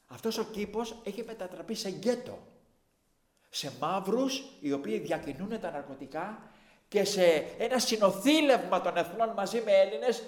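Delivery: loud, high preaching force. Acted. Preacher describing the park to his congregation, preaching. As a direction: rail against it from the pulpit.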